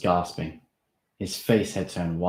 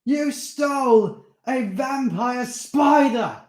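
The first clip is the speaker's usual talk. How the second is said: slow, much higher, louder shout; 9 dB louder, much slower than usual. Acted. Steve shouting at Darren about the theft. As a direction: shout it in disbelief. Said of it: "Steve shouted".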